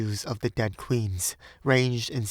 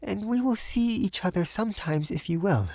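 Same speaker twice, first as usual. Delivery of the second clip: high, tentative feminine offer; deliberate young Frenchwoman; 10 semitones higher than usual. A narrator's reading. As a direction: propose it high and carefully.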